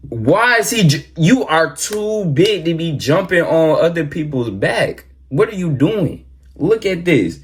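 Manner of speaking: high-pitched, exasperated protest; appalled scolding. Real, not acted. Reactor telling off a player over a dirty jump, appalled.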